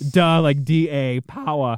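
"Duh" hit hard in place of "the"; mock "power" as "powah", dropping the final r; mimicked mispronunciation. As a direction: say "Duh" bluntly, then spell it out. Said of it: "typing the way she said it".

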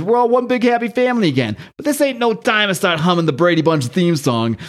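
lightly voiced gruff hero, pitched high on the first line; a hint of a tough-guy superhero; a big teammate mocking the family reunion, lightly voiced; the Thing. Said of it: "and Fink says".

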